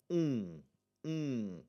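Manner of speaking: un as a nasal 'oo', lips left open. Shown as a demonstration. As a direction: hum 'un' through the nose without closing on an m. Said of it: "nasally U".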